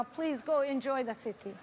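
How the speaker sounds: ordinary delivery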